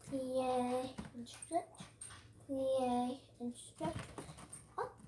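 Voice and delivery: drawn-out ballet cue; slow drawn-out cue. Shown as a demonstration. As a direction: stretch each word with the move. Teacher cueing moves slowly.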